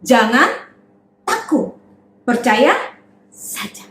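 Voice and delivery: slow, gentle comfort; calm the frightened father; lightly reassuring voice; reassuring Jesus. Acted. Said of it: "berkata kepada kepala rumah ibadat".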